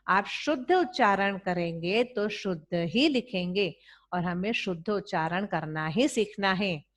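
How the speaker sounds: ordinary talk, nothing marked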